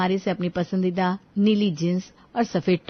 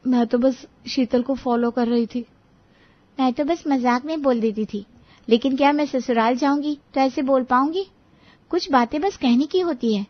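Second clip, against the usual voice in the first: lightly voiced, higher-pitched excuse; defensive young woman; 5 semitones higher than usual. A narrator's reading. Young woman justifying her joking to mother-in-law, defensive. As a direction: explain yourself to your elder.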